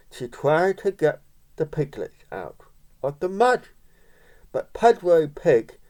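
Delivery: plain reading voice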